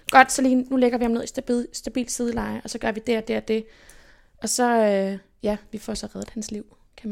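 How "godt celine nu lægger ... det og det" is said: louder, brisk instruction to a friend; firm take-charge call. Retold or imitated. Friend Rune takes charge in an emergency, firm and louder. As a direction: take command in a crisis, louder. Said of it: "han var sådan".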